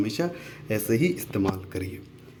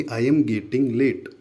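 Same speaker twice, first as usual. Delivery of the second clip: very slow, word by word; slow teacher demo; slow model phrase; much slower than usual. Shown as a demonstration. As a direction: say it slowly for the class to copy. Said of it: "तो आप बोलिए".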